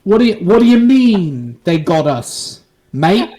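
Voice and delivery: slow, hammed-up British protest; Prince Andrew, mock British. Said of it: "Here's my best Prince Andrew".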